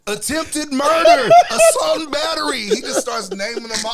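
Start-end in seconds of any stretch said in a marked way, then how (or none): 0.1-2.6 shouted charges. Retold or imitated. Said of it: "He's like".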